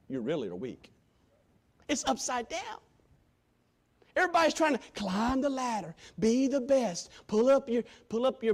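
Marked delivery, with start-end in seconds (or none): none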